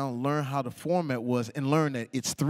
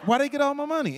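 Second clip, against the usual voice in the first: mock outrage; 8 semitones higher than usual. New winner baffled by tax deductions, mock outrage. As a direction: protest it, pitch climbing sharply.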